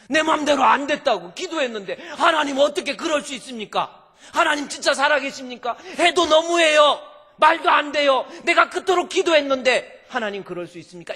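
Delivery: loud, high protesting whine at God; hammed-up grievance of a disappointed believer; complaining believer. Believer protesting to God in prayer, loud and high.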